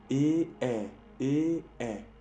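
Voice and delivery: E, E, E, E, an open é said loud with a wider mouth; French é vowel drill. Shown as a demonstration. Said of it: "¿Ves mi boca?". (E, E con la boca un poco más abierta, más fuerte)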